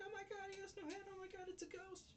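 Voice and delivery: high-pitched mock panic squeal; absolutely terrified. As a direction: imitate a girl terrified of a ghost. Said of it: "absolutely terrified".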